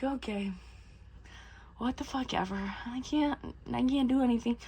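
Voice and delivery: very soft, half-swallowed grumble; mock sulky resignation. Odysseus overruled by his right-hand man, mock quiet resignation. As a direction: give in under your breath, acted. Odysseus gives in to first mate.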